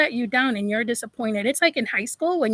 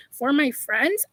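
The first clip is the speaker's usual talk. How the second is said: ordinary talk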